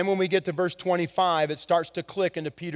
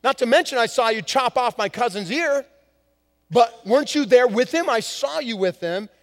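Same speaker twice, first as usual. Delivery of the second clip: accusing cousin; 5 semitones higher, 4 dB louder than usual. Retold or imitated. Servant's relative accuses Peter, hammed-up angry. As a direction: confront him about the cut-off ear. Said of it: "he says".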